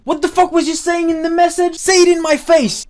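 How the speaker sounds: high, loud acted-out screaming demand; shouting. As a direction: reenact a furious confrontation at a high-pitched yell. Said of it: "she just started shouting at me".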